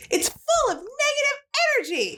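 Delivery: very high-pitched pretend rant; mock yell. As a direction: keep venting in a hammed-up high voice. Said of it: "And she yells".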